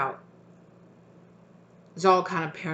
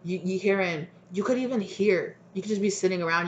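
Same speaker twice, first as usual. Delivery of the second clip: normal talking voice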